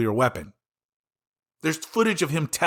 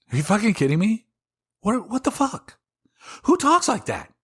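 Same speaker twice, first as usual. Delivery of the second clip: much higher, disbelieving what-the-hell cry; incredulous outrage; 9 semitones higher than usual. Real, not acted. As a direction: shriek it in disbelief at the absurdity. Outraged at Trump's genocide threat.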